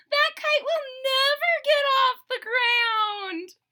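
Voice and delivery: high, loud, slow mocking shout; robins' voice. Robins jeering at Toad's failed kite, high mocking shout. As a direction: jeer down at the kite like a cheeky bird. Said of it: "said the robins".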